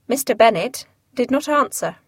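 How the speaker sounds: usual speaking voice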